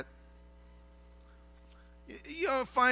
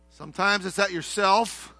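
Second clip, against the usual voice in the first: ordinary talk, nothing marked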